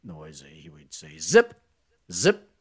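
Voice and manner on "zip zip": higher, louder mock teacher command; stern teacher. Teacher silencing a noisy class.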